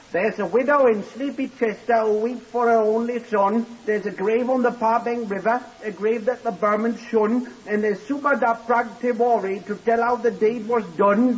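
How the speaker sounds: a shy porter proudly reciting Kipling to the inspector. Acted. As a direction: declaim the verse like a schoolboy showing off. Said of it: "And I know Kipling quite well".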